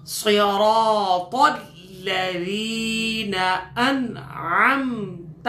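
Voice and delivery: slow, melodic Quranic chanting; slow tajweed recitation; verse recitation. Shown as a demonstration. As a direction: recite the fragment slowly and reverently. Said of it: "اس آیت کے ٹکڑے کو پڑھ لیتے ہیں".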